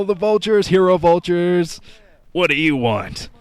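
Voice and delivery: high, loud vulture screech. Acted. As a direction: squawk it out like the unit. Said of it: "my vulture impression".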